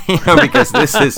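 high, loud words broken by laughter; laughing. Real, not acted. Podcaster cracking up at own Batman joke, laughing. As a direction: giggle through the words.

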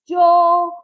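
second, stronger shout for Joe. A narrator's reading. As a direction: call out again, harder, to a lost brother. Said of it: "I shouted again".

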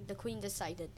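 plain reading voice

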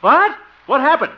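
sharp, high, loud disbelief; jolted out of his daydream; shocked. Acted. Uncle hears the nephew lost the job, shouts in shock.

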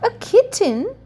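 high-pitched storybook exclamation of surprise; exclaiming. A narrator's reading. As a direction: exclaim it high, as a child's discovery. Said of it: "exclaimed Becky".

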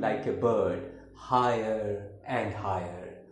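measured, declaimed poem with swelling intonation; formal dramatic verse reading; poetry recitation. Acted. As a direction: recite it line by line, weighting key words. Recites father's poem The Wall.

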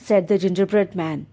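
ordinary delivery

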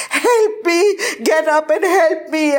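high, crying call; desperate pleading. A narrator's reading. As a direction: beg someone to wake up. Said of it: "I was moaning".

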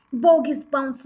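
usual speaking voice

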